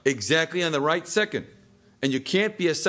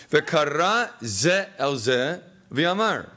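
reads "V'kara ze el ze v'amar" word by word, very slow, falling at the end; slow, careful reading with a final fall; slow Hebrew reading; much slower than usual. Shown as a demonstration. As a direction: spell the Hebrew out slowly for the class. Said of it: "Follow this".

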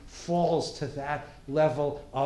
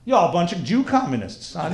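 put-on Texan farmer accent; 6 dB louder than usual. Farmer accusing students, mock-indignant Texan accent. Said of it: "if you're gonna talk with that accent".

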